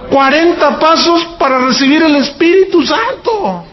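high-pitched, drawn-out disbelief; incredulous protest. Retold or imitated. Retelling his outrage at forty steps. (protesta incrédula, con voz aguda)

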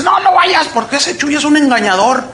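high-pitched imitation of an alarmed warning; mock urgent warning. Pastor warning member away from the event, mock urgent. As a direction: imitate someone forbidding it, starting high and easing down.

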